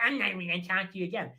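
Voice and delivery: rushed, garbled mocking imitation; mock her in a fast, put-on voice; bitch voice. Mockingly repeating her threat, rapid bitch voice. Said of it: "in a bitch voice".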